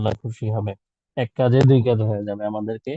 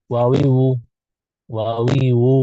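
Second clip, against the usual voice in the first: normal talking voice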